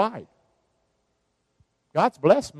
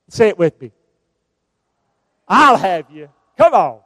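rousing call; 9 dB louder than usual. Acted. Preacher rallying the congregation.

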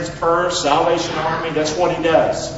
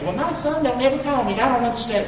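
complaining parent; 7 semitones higher, 3 dB quieter than usual. Retold or imitated.

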